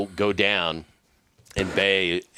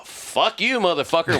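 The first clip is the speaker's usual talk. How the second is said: retold angry retort; 8 semitones higher than usual. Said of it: "Is him screaming at Harry".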